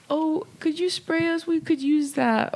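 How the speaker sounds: high, slow, pleading mock-tearful voice; a hammed-up distraught stranger asking for help; imitating grieving couple. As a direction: plead slowly and high like a heartbroken parent. Grieving couple asks for spray.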